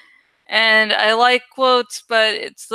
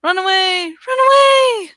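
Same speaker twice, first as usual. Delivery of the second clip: high shrieking run away, louder on the second; Monty Python run away; 10 semitones higher, 5 dB louder than usual. Retold or imitated. Quoting Monty Python knights, high panicked shout. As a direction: squeal it like the fleeing knights. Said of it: "the little reference".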